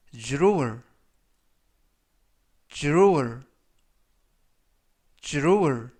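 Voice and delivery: "drawer" opening with a j-like dr, falling; DR said as "juru". Shown as a demonstration. Teacher showing the DR sound, drawer with j-like onset. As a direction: model "drawer" as JROO-er, each one falling. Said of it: "디알 발음이".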